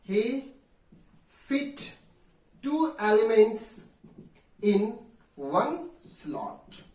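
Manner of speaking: one word at a time, spaced out; slow dictation. Real, not acted. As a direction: dictate slowly, pausing after each word. Dictates while writing on board. (एक-एक शब्द रुक-रुक कर लिखवाते हुए)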